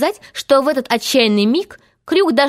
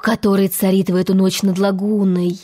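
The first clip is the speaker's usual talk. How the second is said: usual speaking voice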